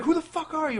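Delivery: high, panicked mock yell, acting startled; freaked-out reaction. Nico reacting to Pinhead popping up, high panicked mock yell. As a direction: squeal it out like a kid who just saw a monster. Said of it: "He's like".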